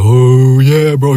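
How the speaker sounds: loud, rising mock hyped-up bro; lit-up excitement. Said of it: "his eyes were, like, lit up".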